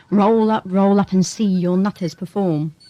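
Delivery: loud, hammed-up carnival barker calling the crowd; mock fairground barker. Doctor mocking the asylum to a colleague, as a loud mock fairground barker. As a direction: bellow it like a sideshow huckster. Mocks the asylum as a freak show.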